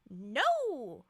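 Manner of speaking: No! punched hard and loud; stress on No. A narrator's reading. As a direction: hit No! with force. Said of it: "No, in bold".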